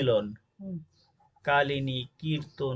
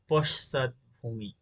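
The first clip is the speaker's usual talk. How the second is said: পশ্চাৎভূমি drawn out very slowly; slow spelling word; much slower than usual. Shown as a demonstration. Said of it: "এটা হচ্ছে শুদ্ধ বানান".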